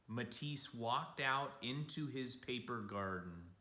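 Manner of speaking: usual speaking voice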